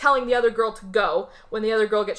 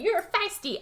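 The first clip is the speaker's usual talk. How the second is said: high, teasing crazy-chick voice; crazy attacker; 10 semitones higher, 3 dB quieter than usual. Retold or imitated. Crazy chick to her feisty victim, mock-crazy teasing. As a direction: imitate a crazy woman relishing it, pitched high. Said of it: "the crazy chick comes up to her".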